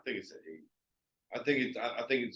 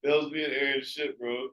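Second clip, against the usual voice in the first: excited outburst; 5 semitones higher, 6 dB louder than usual. Retold or imitated. Reliving excited reaction to movie.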